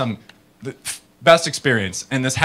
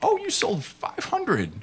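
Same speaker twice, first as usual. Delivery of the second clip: mock surprise; 10 semitones higher, 4 dB quieter than usual. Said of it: "they were like".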